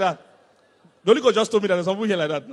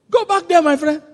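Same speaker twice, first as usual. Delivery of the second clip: loud rebuke; 9 semitones higher, 11 dB louder than usual. Real, not acted. Rebuking listener.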